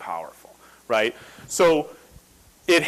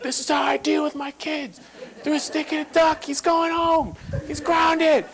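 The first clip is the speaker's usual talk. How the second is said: much higher, announced for other parents to hear; a hammed-up strict father putting on a show; showy strict dad. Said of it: "so the other parents could see".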